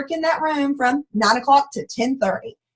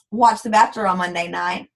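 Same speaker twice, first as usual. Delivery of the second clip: ordinary talk, nothing marked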